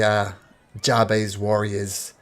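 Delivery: normal talking voice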